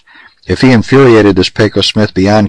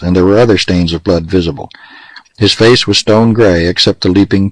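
ordinary delivery, nothing marked

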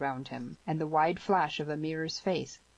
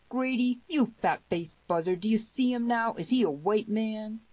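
raised-pitch bullying demand; thundered challenge; 6 semitones higher than usual. A narrator's reading. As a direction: press him for an answer, high and forceful. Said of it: "thundered Harmony".

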